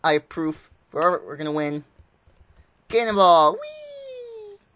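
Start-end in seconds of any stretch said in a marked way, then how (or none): 2.9-3.5 loud, high whoop of a yell; cannonball yell. Real, not acted. Jumping into the void. 3.5-4.7 very high, soft squeal; gleeful whee. Real, not acted. Falling into the void.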